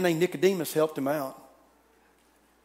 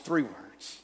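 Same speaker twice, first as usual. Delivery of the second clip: ordinary talk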